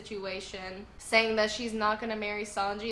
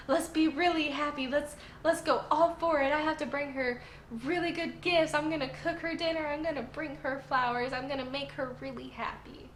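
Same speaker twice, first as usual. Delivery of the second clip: higher-pitched, put-on happy pep talk; forced cheerfulness; 6 semitones higher than usual. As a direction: hype yourself up with acted excitement. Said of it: "being really happy and excited".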